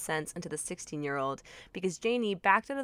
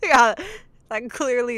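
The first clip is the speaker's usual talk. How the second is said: loud sarcastic; 4 semitones higher, 9 dB louder than usual. Real, not acted. Sarcastic reaction.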